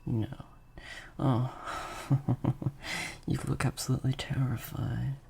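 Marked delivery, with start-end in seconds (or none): 1.6-3.2 breathy laugh at the captive's fear; amused dark chuckle. Acted. Mocking terrified captive.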